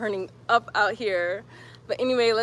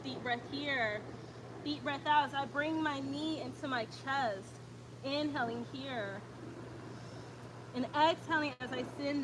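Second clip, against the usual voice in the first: calm, even yoga class cues; steady instructor voice pacing the breath; yoga instructor cueing; 13 dB quieter than usual. Acted. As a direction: guide the class through each breath. Leads a yoga flow.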